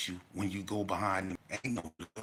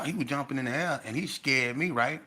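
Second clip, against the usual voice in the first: ordinary talk, nothing marked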